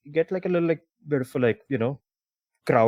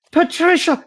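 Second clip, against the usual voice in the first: high, loud mock-startled cry; startled greeting; 6 dB louder than usual. Cheater greets yet another lover, startled cry. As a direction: exclaim the name as if caught out. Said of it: "he turns and goes like".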